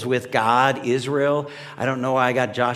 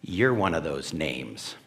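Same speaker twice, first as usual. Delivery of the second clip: quiet voice of God; 7 semitones lower, 5 dB quieter than usual. Retold or imitated. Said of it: "this voice from somewhere outside of me goes".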